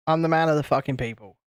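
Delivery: ordinary talk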